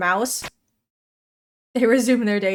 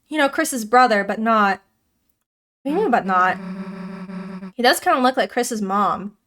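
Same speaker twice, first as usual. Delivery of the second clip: normal talking voice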